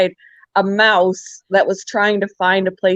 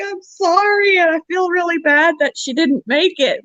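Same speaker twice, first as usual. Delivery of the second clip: high-pitched mock-pleading sorry; retold apology; 9 semitones higher than usual. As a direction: imitate yourself apologising in a much higher voice. Retold apology to upset son.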